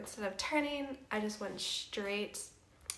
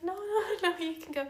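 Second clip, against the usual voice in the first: high, laughing no no no; laughing protest; 9 semitones higher, 6 dB louder than usual. Retold or imitated. After a crash, insisting through laughter the kind stranger can leave. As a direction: wave him off with an embarrassed laugh. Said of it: "I'm like".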